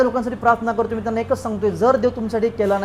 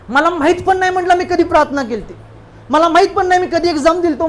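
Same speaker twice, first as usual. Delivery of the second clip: excited retelling; 6 semitones higher, 4 dB louder than usual. Retold or imitated.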